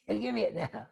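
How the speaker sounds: laughing as he demands it; amused mock demand. Teacher replaying the prince's demand, amused.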